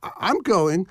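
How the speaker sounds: high, stammering tearful plea; sobbing. A narrator's reading. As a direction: stumble through the words in tears. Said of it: "she sobbed".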